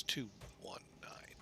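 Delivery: whispered to himself. Real, not acted. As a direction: mutter the numbers under your breath. Re-entering after doing it wrong.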